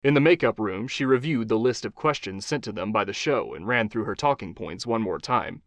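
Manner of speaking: usual speaking voice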